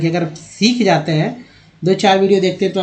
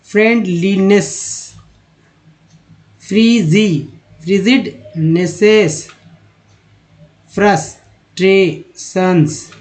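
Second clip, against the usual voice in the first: each long word read slowly, one at a time; slow word drill; much slower than usual. Shown as a demonstration. Teacher reads the spelling word list aloud, slowly. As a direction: pace out every word for learners to copy.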